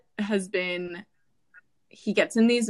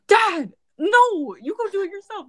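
high loud mock whine; mock refusal; 11 semitones higher, 5 dB louder than usual. Daughter refusing her dad's fetch request. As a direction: push back in a high whine. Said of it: "And I'm like".

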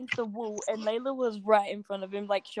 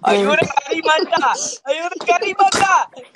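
high, loud mock Indian accent; exaggerated Indian accent, pitched high; 6 semitones higher, 10 dB louder than usual. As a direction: shout it in a put-on Indian accent. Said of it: "Indians are an exception".

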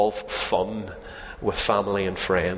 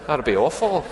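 mock horror; 9 semitones higher than usual. Real, not acted. Mocking strict sabbatarians.

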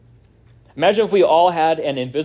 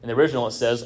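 ordinary talk, nothing marked

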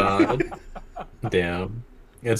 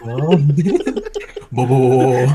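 mock vocal warm-up; 6 semitones higher, 9 dB louder, much slower than usual.

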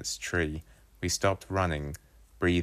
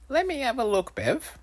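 put-on boy voice. Wolfie voiced by narrator.

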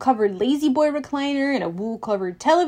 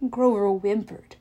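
plain reading voice

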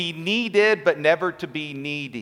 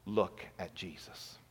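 much softer and lower, slowed down; hushed emphasis; 8 semitones lower, 15 dB quieter than usual. Real, not acted. Pastor pointing his flock to Jesus, hushed and slow. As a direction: drop to a near murmur to make the point.

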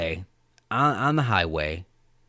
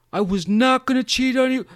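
high, loud put-on protest; mock earnest denial; 14 semitones higher, 7 dB louder than usual. Imitated Cotton reassuring girlfriend, insistent.

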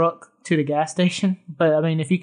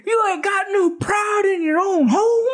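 much higher, put-on cook shouting at Leatherface; indignant cook; 14 semitones higher than usual. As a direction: holler it like a house-proud hillbilly. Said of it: "It's just the way he says it".

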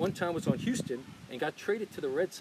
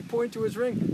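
ordinary talk

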